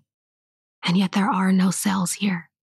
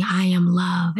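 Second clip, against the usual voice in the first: ordinary delivery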